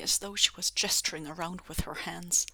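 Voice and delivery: plain reading voice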